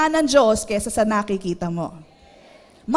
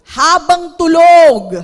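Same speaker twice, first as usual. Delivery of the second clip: loud emphasis; 3 semitones higher, 13 dB louder, slower than usual. Real, not acted. Stage-loud tip opener.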